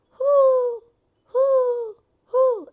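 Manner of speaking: very high, loud hooting "who"; imitating the owl outside the house, high and loud; owl hoot imitation. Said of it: "The only thing that who is an owl".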